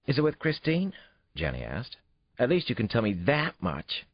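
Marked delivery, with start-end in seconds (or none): 0.1-0.9 put-on high, eager child's voice; pry like a nosy kid; curious little girl. A narrator's reading. Daughter quizzes her dad about his date, eager and curious. 2.4-4.1 put-on high, eager child's voice; pry like a nosy kid; curious little girl. A narrator's reading. Daughter quizzes her dad about his date, eager and curious.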